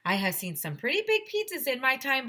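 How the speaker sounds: a hint of the English accent, eager; light English lady. English record lady eager to see the pizza, light English accent.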